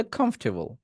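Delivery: Comfortable quickly as "khomf-te-bol"; quick, joined up. Shown as a demonstration. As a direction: run comfortable together without gaps. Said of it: "দ্রুত বললে". (দ্রুত বলা comfortable)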